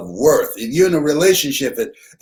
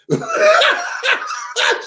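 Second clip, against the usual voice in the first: a real, high-pitched laugh bursting out; high burst of laughter. Real, not acted. Audience hears the grits punchline, he bursts out laughing high. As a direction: let the laugh explode up high.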